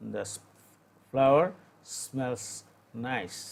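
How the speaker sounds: slow model reading; teacher's slow model sentence; slow dictation. Shown as a demonstration. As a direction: dictate it word by word. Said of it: "teacher reads English example".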